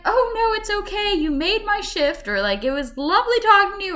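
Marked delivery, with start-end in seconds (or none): 0.0-2.3 very high, sweet imitated voice; imitated kind cashier. Cashier brushes off an apology, high imitated reassurance. 2.6-4.0 high, louder sweet cashier voice; imitated kind cashier. Cashier thanks the customer, high imitated gushing.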